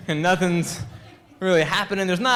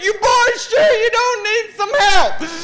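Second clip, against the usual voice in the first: high, loud, drawn-out mock holler; hollered offer; 14 semitones higher, 7 dB louder, slower than usual. Volunteer firefighters calling down to the screaming boys, hollering. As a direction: call down slowly and high, imitating rural rescuers.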